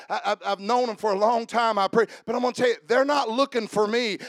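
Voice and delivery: usual speaking voice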